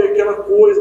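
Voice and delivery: normal talking voice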